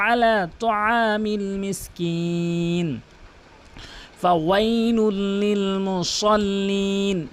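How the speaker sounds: slow tajweed chant; long sustained vowels, careful throat letters; Quran recitation. Shown as a demonstration. Teacher recites the verses, slow measured chant.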